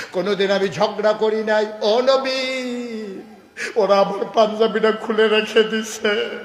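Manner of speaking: rising to a high, loud wail; anguished plea. Acted. Companion complaining to the Prophet of being held back, building tearful wail. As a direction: plead your hurt, building to a cry.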